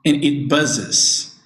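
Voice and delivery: "buzzes" said slowly, z sounds drawn out; slow model word. Shown as a demonstration. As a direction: stretch out "buzzes" for the students. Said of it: "for example".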